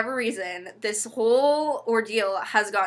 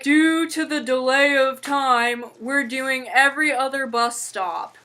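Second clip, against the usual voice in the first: bus driver announcement; 4 semitones higher, 4 dB louder than usual. Retold or imitated.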